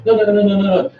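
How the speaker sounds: high, loud rapid-fire objection; mock protest. Acting out Spanish students' protest.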